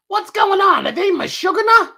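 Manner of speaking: put-on high, loud Brooklyn old lady. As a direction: squawk it like a Brooklyn grandma.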